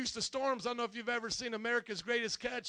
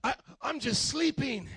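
louder, higher protest of innocence; mock excuse; 5 semitones higher, 5 dB louder than usual. Sleeper justifying himself to those around him, loud mock excuse. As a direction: insist it as if nothing is wrong. Voicing someone justifying their numbness.

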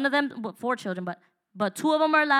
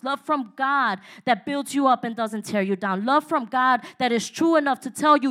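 ordinary talk, nothing marked

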